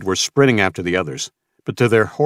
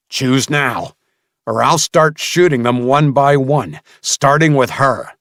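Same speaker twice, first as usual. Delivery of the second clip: louder, higher furious demand; furious threat; 6 semitones higher, 5 dB louder than usual. Acted. Mason forcing Samuel to choose, furious threat. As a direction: demand it with a gun raised.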